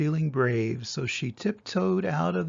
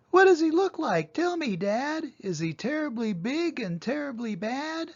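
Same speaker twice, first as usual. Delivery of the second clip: put-on eager little monster child, much higher; 8 semitones higher than usual. Child asks her dad, much higher and eager. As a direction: ask Dad in a high, wide-eyed voice.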